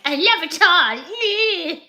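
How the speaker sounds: mock-proud of a huge collection; drawn-out self-satisfied coo; smug hoarder. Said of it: "being like".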